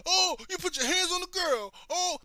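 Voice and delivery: high put-on scandalised voice; mocking crowd. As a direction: sneer at the hypocrites.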